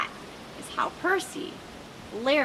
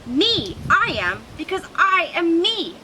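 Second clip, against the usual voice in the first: higher and louder, crowing about yourself; proud shout; 7 semitones higher, 5 dB louder than usual. Acted. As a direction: shout it like a proud little lizard. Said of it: "I shout".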